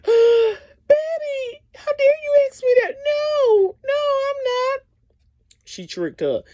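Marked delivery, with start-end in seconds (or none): 0.0-4.8 high, wailing, tearful protest; crying denial. Retold or imitated. Kandi wailing her innocence at Betty, crying high and tearful. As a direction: cry out the denial like she's been wronged. Kandi denies affair to wife.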